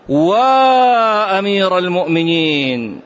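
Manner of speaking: a long, loud, drawn-out wail of grief; crying out. Retold or imitated. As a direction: call it out slowly like a mourning cry across the rooftops. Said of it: "صاحت الجارية". (صرخة نعي طويلة عالية)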